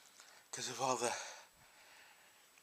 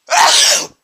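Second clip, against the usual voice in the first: sudden loud sneeze; 25 dB louder than usual. Real, not acted. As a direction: sneeze before you can warn. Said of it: "I sneezed before I could say".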